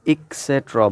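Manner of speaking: "et cetera" with K for T, ek-SET-ra; wrong pronunciation. Retold or imitated. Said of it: "which is wrong".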